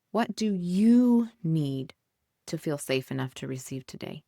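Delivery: drawn-out, weighted question; slow, emphatic question. Shown as a demonstration. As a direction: ask it slowly, letting each word land. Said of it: "asks the question, then repeats it".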